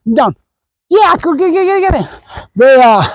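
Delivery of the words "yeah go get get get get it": high, rapid-fire egging on; excited urging. Real, not acted. Trainer sending his dog after the ball, excited urging. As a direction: send the dog off in a high, eager voice.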